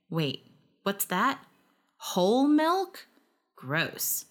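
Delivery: slow surprised then grossed out; a double take, then yuck; disgusted. A narrator's reading. Disgust at whole milk.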